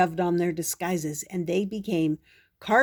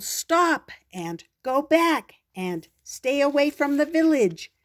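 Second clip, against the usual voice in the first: higher, louder shouted warnings; yelling; 8 semitones higher, 5 dB louder than usual. Acted. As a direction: shout to stop the giant. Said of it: "They yelled".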